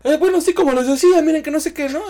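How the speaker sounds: high, loud, flustered stage patter; flustered comedian. Retold or imitated. Comedian on stage covering after a girl spits her food, flustered. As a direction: cover the awkward moment, rattled. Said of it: "Y yo".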